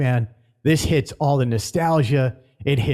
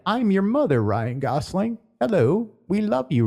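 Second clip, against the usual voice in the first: mock loving robot mother; 6 semitones higher than usual. Robot hugging gosling, mock mother.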